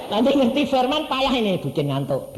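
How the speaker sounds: high, loud taunting devil; mocking devil voice. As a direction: sneer as the devil. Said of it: "Nah, setan ini tahu". (suara setan mengejek, tinggi dan keras)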